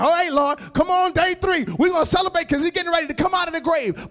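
loud, high, fast and eager; hyped-up imagined celebration; excited celebration. Retold or imitated. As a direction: cheer it on like you already know the ending. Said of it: "We can read that scripture and be like".

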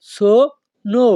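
সোনো drawn out slowly, o sounds held; slow model pronunciation. Shown as a demonstration. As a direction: stretch সোনো slowly for the learners. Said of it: "এর সঠিক উচ্চারণ হবে". (ধীরে ধীরে সোনো উচ্চারণ)

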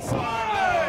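high loud outburst; excited shout. Real, not acted. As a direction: cry out at the big finish. Reaction to finishing powerbomb.

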